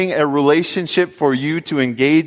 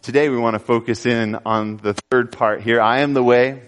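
ordinary talk, nothing marked

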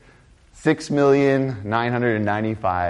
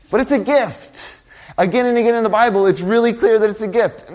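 emphatic preaching; 10 semitones higher, 3 dB louder than usual. Real, not acted. Emphatic climax, gift.

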